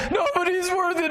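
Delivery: high-pitched mock wail; mock crying. Preacher acts out John's despair over the scroll, mock crying. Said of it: "He was crying".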